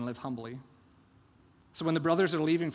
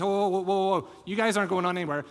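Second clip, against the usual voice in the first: loud, fast call of whoa to halt them; calling out to stop them; 4 semitones higher, 5 dB louder than usual. Acted. Steward chasing down the brothers, calling loudly to stop. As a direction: shout them to a stop, then rattle it off.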